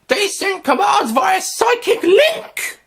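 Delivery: very high, slow, alien rasp; alien creature voice. Acted. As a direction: voice a cold alien hive creature.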